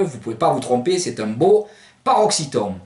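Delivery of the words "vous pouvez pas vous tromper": ordinary talk, nothing marked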